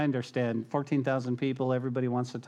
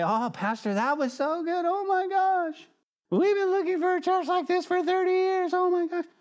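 much higher, breathless gushing fan voice; gushing churchgoer; 3 dB louder than usual. Retold or imitated. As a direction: gush like an overexcited newcomer. Gushing churchgoer praising the pastor.

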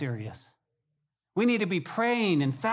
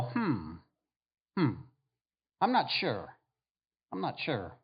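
doubtful jury; 5 semitones lower, 4 dB quieter than usual. Acted.

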